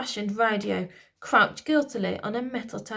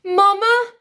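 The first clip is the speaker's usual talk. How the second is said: very high, loud call; high loud child's cry; prince crying out; 12 semitones higher, 11 dB louder than usual. A narrator's reading. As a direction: cry out to his mother. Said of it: "cried Alfred".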